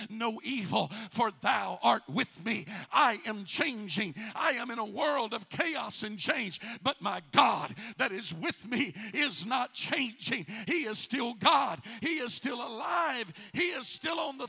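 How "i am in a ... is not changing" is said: ordinary delivery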